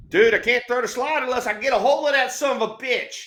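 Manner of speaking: high, fast excited teammate; acted-out animated explanation; imitated teammate. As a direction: rattle it off high, pleading your case. Acting out teammate's urgent explanation.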